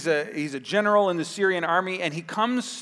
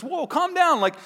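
calming servant; 6 semitones higher than usual. Retold or imitated. Servant calming the furious general.

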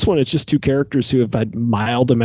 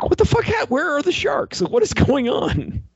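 high, disbelieving squeal of confusion; shrill mock outrage at the movie's turn; incredulous outburst. Reacting to sharks vanishing from the plot, high incredulous outburst.

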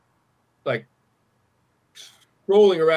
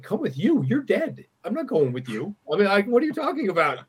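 higher, disbelieving, pushing the idea away; as if he'd lost his mind; incredulous retort; 6 semitones higher than usual. Retold or imitated. Retelling refusing the dead father, incredulous.

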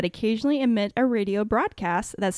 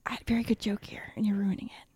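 whispering; 7 dB quieter, faster than usual. Real, not acted. Whispered mock complaint.

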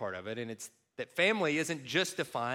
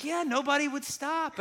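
1 stranger's reply; 10 semitones higher than usual. Retold or imitated. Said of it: "he's like".

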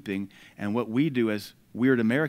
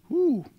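whew; 3 dB louder than usual. Real, not acted. Reacting to strong scripture.

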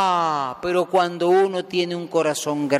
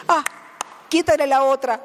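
mock generous offer; 8 semitones higher, faster than usual. Preacher mocking a wrong Jesus to the congregation, hammed-up offer. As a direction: play it as a silly, wrong Jesus.

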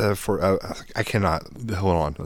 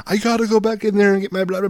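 mock whiny friend; 13 semitones higher, 4 dB louder than usual. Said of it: "He'd be like".

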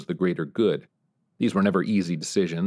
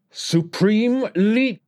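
much higher mocking dictator's whine with a Korean accent; high-pitched whine; 14 semitones higher, 4 dB louder, much slower than usual. North Korean leader mocking the US president, high Korean-accented whine. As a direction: sneer and jeer in a shrill Korean-accented whine. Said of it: "that high-pitched whine".

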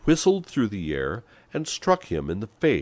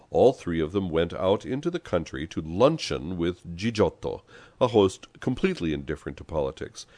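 usual speaking voice

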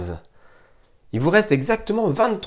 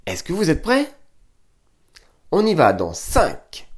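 normal talking voice

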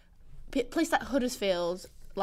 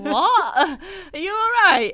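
loud, high mock disbelief; exaggerated bewilderment, rising high and loud; baffled; 7 semitones higher, 8 dB louder, much slower than usual. Reacting to housemate's strange sayings, loudly baffled. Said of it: "I'm just there like".

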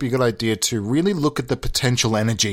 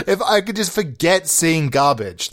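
ordinary talk, nothing marked

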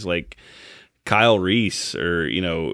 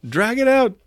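mock coaching, higher. Actor keeps prompting the judge, pitched even higher. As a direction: push it further up as the urging grows. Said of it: "Daniel Day-Lewis was motioning".

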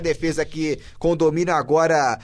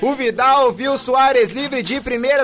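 roaring goal call, drawn-out and high; explosive goal commentary; 8 semitones higher, 7 dB louder than usual. Real, not acted. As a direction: scream the goal with full joy. Goal scored, narrator erupts.

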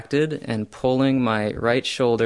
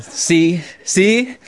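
triumphant; 8 semitones higher, 6 dB louder than usual. Retold or imitated. Retold triumphant self-talk.

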